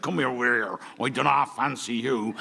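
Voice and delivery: put-on low pirate accent, lightly done. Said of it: "I met a pirate recently who said to me".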